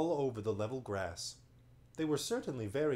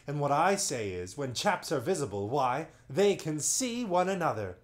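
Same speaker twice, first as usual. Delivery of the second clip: louder self-important chief voice; pompous chief; 3 semitones higher, 6 dB louder than usual. Acted. Chief monopod explaining the obvious to his tribe, pompous. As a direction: boom it out like he's clever.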